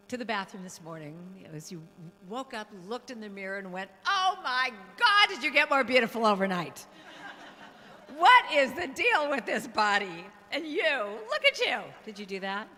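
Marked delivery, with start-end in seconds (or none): 4.0-11.8 loud, high, gushing delight; mock self-admiration. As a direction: gush at your reflection, hammed-up. Acts out admiring oneself in mirror.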